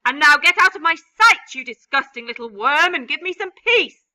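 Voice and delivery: loud, high shouted dismissal; loud furious aunt. Acted. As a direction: drive him off with a yell. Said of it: "aunt shouting at James".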